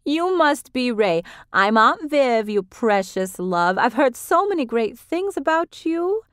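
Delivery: put-on high, gushing older woman; Vivian voiced high. A narrator's reading. Vivian greets Ray.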